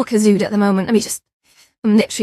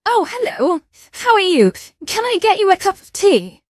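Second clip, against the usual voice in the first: higher, sing-song posh hostess voice; posh tea-shop server; 7 semitones higher than usual. Acted. Streamer as a butler serving tea to chat, posh voice. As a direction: play a prim waitress greeting a guest. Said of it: "I feel like a Karen in a restaurant".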